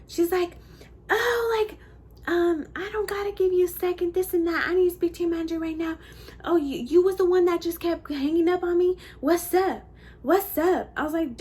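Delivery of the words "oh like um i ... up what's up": high-pitched mock confrontation; picking a fight. Customer trying to fight the worker, high confrontational imitation. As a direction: imitate a belligerent customer demanding the manager. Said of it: "trying to fight me".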